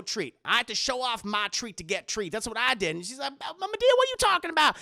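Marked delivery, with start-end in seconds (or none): 3.4-4.8 higher, louder and fast, a hammed-up woman's voice; high mock Aunt Bam. Aunt Bam asking Madea in a high, fast voice. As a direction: squeal it out in disbelief.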